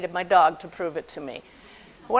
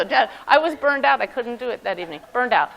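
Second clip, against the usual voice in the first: normal talking voice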